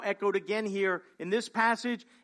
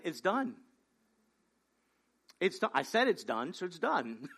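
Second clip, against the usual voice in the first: "It's done" said lower and softer, calmly falling on "done"; soft matter-of-fact restatement; 4 semitones lower, 5 dB quieter than usual. Acted. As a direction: quietly insist it's settled. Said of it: "But, but it hasn't happened".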